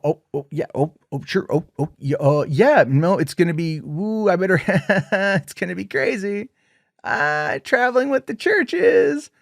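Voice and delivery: nervous excited babble with a laugh; mock giddy Mitzi. Mitzi brushing off Pearl, gushing in a high giddy voice.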